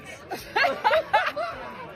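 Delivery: high-pitched burst of laughter; laughing. Real, not acted. Woman laughing off an awkward rejection, high laugh.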